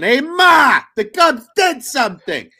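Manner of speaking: put-on high, loud New York accent shout. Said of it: "the comments says in a New York accent".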